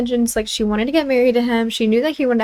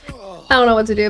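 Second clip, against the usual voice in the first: laughing. Real, not acted. Laughing at own confusion.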